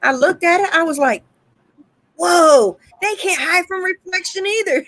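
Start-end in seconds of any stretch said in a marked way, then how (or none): 2.2-4.8 high amazed whoa; surprised. Retold or imitated. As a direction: gasp out the discovery. Retold discovery, high and loud.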